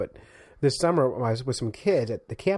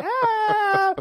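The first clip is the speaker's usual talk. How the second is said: high frog squeal; short burst of frog screeching; imitated frog scream; 4 dB louder than usual. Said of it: "it just sounds just like that".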